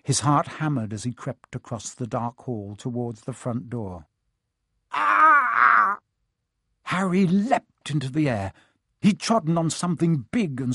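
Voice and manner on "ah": high sudden cry of fright; startled yelp. Acted. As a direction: yelp in shock. Stepping on something squashy.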